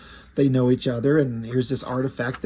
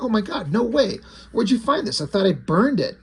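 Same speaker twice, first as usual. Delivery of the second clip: higher-pitched, retold astonishment; amazed disbelief; 7 semitones higher than usual. David sees his long-lost comic, amazed disbelief. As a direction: act out stunned recognition, voice lifted.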